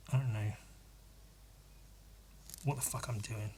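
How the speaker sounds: soft, quick muttering to himself; muttered to self. Real, not acted. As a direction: mutter it under your breath. Muttering to himself while painting.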